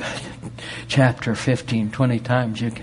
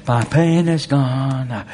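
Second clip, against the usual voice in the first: slow declaration; 2 semitones higher, 3 dB louder, much slower than usual. Pretend.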